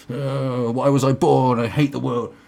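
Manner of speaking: put-on loud, groaning teen wail; hammed-up adolescent misery; whining teenager. Rebellious kid complaining to liberal parents, whining.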